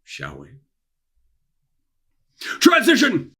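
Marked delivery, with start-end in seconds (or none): 2.6-3.3 much higher, louder announcer yell; shouted cue. Acted. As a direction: belt it out like a show announcer. Shouted cue between video segments.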